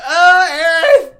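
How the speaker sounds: very high, very loud mock wail; too-loud cry. Fan reacting to Aerith's theme, wailing shout. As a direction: wail the name like an overwhelmed fan. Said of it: "I said it too loud".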